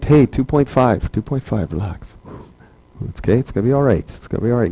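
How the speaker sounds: playful reassuring teacher, fading to soft; mock soothing. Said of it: "I'm like".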